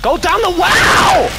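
panicking gamer. Real, not acted. Urging teammate to escape.

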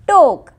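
टोक lifted high and loud; teacher marks the key word; stressed word. Shown as a demonstration. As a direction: hit टोक clearly. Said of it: "शेवट किंवा".

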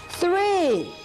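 high, clear count on the beat; count out loud. Shown as a demonstration. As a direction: call the number out loud. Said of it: "we're gonna say that one loud".

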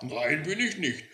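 badly faked disguise; laboriously disguised voice. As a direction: strain to sound like someone else. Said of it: "versuchte die Stimme mühsam, sich zu verstellen".

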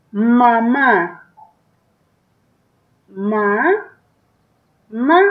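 Mamá spelled out slowly, Ma then Ma, the last syllable stressed; teacher sounding out Mamá; syllable dictation. Shown as a demonstration. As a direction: break Mamá into its syllables for a child. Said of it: "Acento en la última A".

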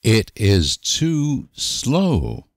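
ordinary talk

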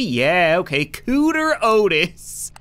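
usual speaking voice

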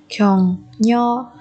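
격려하다 with a pause after each syllable. Shown as a demonstration. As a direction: separate every syllable of 격려하다 for learners. Teacher models word syllable by syllable.

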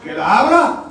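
high, loud retold disbelief; incredulous question. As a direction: blurt it back in comic amazement. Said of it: "Yo le dije".

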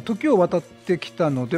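usual speaking voice

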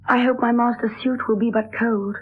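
normal talking voice

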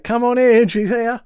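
high, loud mock holler; calling out. Husband inviting the visitor in, retold as a loud call. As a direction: call it out as if to someone at the door. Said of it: "Al just calls out".

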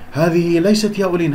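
usual speaking voice

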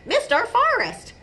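high-pitched eager interjection; excited otter. A narrator's reading. Duffles finishing Nudge's thought, excitedly. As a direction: chime in to finish a friend's sentence.

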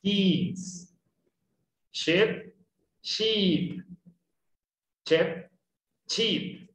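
this and sheep drilled with a wide-open mouth. Shown as a demonstration. Teacher drilling the words with a wide mouth. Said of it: "Chúpese un limoncito".

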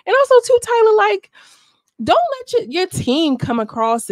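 high, loud call out to Tyler. Real, not acted. Vlogger calling out Tyler directly, loud and high. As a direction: raise the voice and call her out.

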